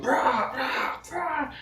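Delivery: rising high mock war cry; mock battle cry. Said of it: "here's me going".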